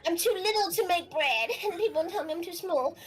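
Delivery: high, halting, stammering little fish voice; a timid young fish explaining itself; shy little fish. Acted. Plays the little fish answering.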